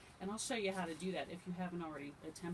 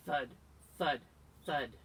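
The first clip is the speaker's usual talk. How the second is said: "thud, thud, thud" as separate heavy beats; each "thud" punched short, like a giant's step; heavy footfalls; 4 semitones lower, 8 dB louder than usual. A narrator's reading. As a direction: pause between each "thud". Voicing the giant's heavy footsteps.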